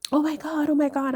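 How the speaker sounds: imitating excited friends, high-pitched; excited squeal. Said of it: "they were all excited".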